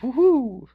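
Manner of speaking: high delighted hoot; swooning whoop. Real, not acted. Guy remembering Gaelic speech, high swooning hoot. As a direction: shiver of delight out loud.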